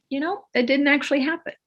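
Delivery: light, quick, high casual aside; blasé minor correction; low-key, nonchalant. Shown as a demonstration. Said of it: "it's low key, nonchalant, blasé, casual".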